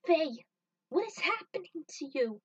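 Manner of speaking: pleading call. Acted. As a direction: beg her to snap out of it. Pleading with a turned friend.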